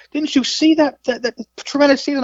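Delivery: high-pitched imitated interviewer disbelief; incredulous. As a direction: press the clueless guest, astonished and high. Incredulous interviewer presses him.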